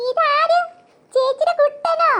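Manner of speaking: high, sing-song cooing; baby talk. Acted. Elder sister greeting the baby, high cooing baby talk. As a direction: coo playfully to a baby.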